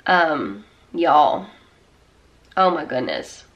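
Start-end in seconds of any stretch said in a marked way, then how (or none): none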